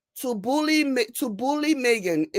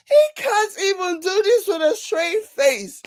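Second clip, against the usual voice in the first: high-pitched, just out of laughter; high, laughter-strained exclamation; hilarious disbelief; 9 semitones higher than usual. Real, not acted. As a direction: squeal it, still cracking up. Still recovering from laughing fit.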